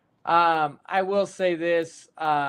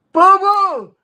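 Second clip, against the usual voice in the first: high, loud yell across the field; calling out; 9 dB louder than usual. Retold or imitated. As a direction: holler her name over the crowd. Dad calls daughter from stands.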